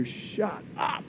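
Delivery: whispered, amazed disbelief; hushed excitement. Acted. Preacher reacting to a favourite verse, whispered excitement. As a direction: breathe it out in excited awe. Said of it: "I just get so excited".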